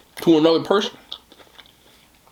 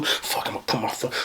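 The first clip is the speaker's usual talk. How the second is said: mock hater mutter; 6 semitones lower, 10 dB quieter than usual. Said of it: "you was like".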